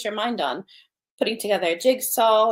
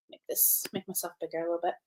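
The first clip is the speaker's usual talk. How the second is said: unvoiced, whispered to herself; muttered aside; 3 semitones lower, 11 dB quieter, faster than usual. Real, not acted. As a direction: whisper it under your breath. Aside while adjusting camera.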